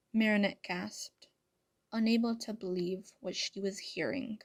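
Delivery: plain reading voice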